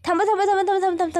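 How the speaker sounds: high, loud, urgent yell of थांबा; sudden loud shout. Acted. Krishna suddenly yells at Dushyant to stop the car, loud urgent shout. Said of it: "Krishna अचानक जोरात ओरडते".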